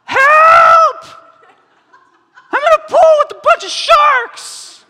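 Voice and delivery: Jimmy yelling from the shark pool, loud and high; panicked shout. Acted. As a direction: yell it laughing like you're drowning among sharks. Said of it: "Jimmy calls out".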